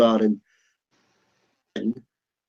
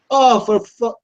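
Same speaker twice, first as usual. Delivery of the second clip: exasperated shout, pitched up and louder; loud outburst; 9 semitones higher, 4 dB louder, slower than usual. Retold or imitated. Brother cursing out loud at the bar, a loud exasperated shout. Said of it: "really out loud".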